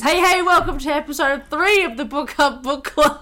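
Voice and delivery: loud, high-pitched show opener; hammed-up podcast host welcome; podcast intro. Shown as a demonstration. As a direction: launch the episode with a big hello. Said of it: "The actual intro is".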